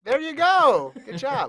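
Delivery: high, loud cheer of approval; excited congratulation. Real, not acted. Host congratulates producer on naming high jump U, excited. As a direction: celebrate the right answer, pitch soaring.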